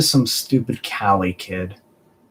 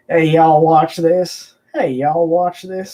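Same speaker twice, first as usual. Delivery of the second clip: higher-pitched Southern drawl; hammed-up Southern accent, pitched up; 4 semitones higher, 4 dB louder than usual. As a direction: put on a playful Southern twang. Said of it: "with the Southern accent".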